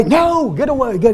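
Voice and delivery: high, put-on voice waving someone off; shooing away. Telling the waiter to leave them alone, high and hammed-up.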